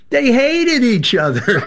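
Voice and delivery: high, slow words through a laugh. Real, not acted. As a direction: laugh while saying it. Laughing over parents' hate.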